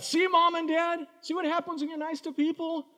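put-on boy complaining to his parents, petulant; petulant kid. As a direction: sulk like a punched kid. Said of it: "And I thought".

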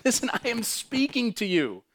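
high, emphatic call to listen; God calling out earnestly; urgent appeal. Acted. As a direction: plead with them to hear you, pitched high.

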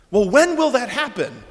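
high, loud rhetorical question; urgent stress on WHEN. Acted. Poses the pressing question rhetorically.

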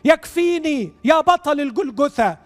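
real heartfelt congregational prayer; fervent prayer. Real, not acted. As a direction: plead to God, phrases rising high then falling away. Leads a fervent prayer aloud.

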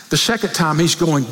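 normal talking voice

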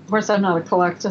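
usual speaking voice

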